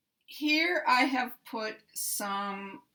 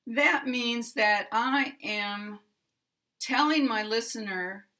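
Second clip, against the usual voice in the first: ordinary talk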